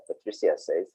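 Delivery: usual speaking voice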